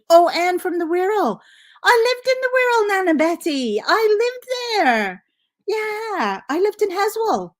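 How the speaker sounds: high, loud, thrilled at a shared hometown; excited surprise. Real, not acted. Viewer from her old home.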